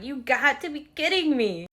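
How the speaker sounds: high, loud mock disbelief; exasperated retold outburst. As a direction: act out being fed up, pitched high and loud. Retelling her upset outburst.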